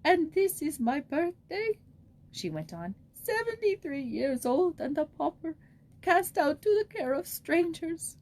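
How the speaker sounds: put-on an old pauper weeping over her birthday; tearful old woman. A narrator's reading. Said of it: "The tears ran down her wrinkled cheeks".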